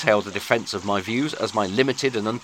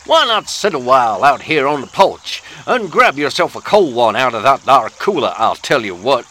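put-on Southern US accent; 5 dB louder than usual. Host welcoming listeners onto the porch, Southern American accent. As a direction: play a neighbourly Southern good ol' boy in a Southern accent. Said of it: "top-drawer dialect work".

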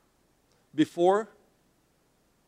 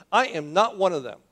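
firm, louder denial; in-her-face denial; 3 semitones higher, 5 dB louder than usual. Acted. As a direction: push back at her, a bit confrontational. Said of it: "got a little bit, uh, a little bit in, in her face".

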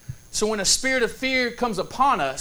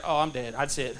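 low, soft, giving up; mock resignation; 8 semitones lower, 7 dB quieter than usual. Boy sure something got him in the dark, quietly resigned. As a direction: drop it down low, as if doomed. Child resigned to his doom.

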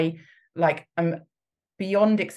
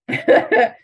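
real laugh; 8 dB louder than usual. Real, not acted.

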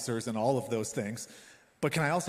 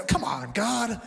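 higher, louder mock plea to God; exasperated cry; 7 semitones higher, 6 dB louder, much slower than usual. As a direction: throw it up at heaven.

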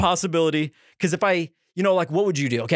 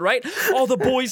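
usual speaking voice

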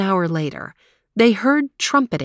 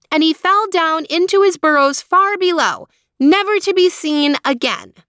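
excited; 9 semitones higher, 4 dB louder than usual. Acted. Dramatic tale of troll's end.

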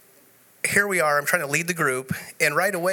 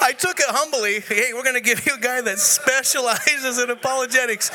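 amused; 9 semitones higher than usual. Real, not acted. Still amused, quoting Tim.

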